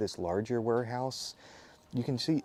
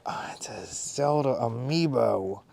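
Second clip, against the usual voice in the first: whisper; slower than usual. Real, not acted. Reveals item softly.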